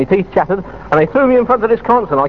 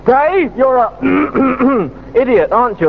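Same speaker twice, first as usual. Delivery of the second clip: lightly voiced boss, pitched up; mock telling-off; 5 semitones higher than usual. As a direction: mimic a boss scolding a hapless DJ, higher. Retold telling-off by programme director.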